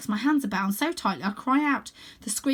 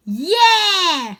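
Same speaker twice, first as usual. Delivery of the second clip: higher, much louder snarl; snarled yeah; 10 semitones higher, 12 dB louder than usual. Acted. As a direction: snarl it as a gang. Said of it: "snarl the other girls".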